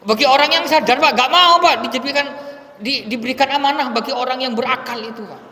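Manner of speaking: fiery preaching. Acted. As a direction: drive the point home from the pulpit. Preaching at full force.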